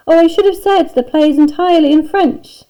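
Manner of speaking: high, loud, lilting call; calling after. A narrator's reading. Teacher shouts after Dennis as he leaves, calling out. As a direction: call out an afterthought to someone leaving. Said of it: "she called after him".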